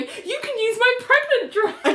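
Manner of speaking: high, giggly retold line; laughing quote. Recapping the show to cohost, laughing quote. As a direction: laugh through Elaine's offer.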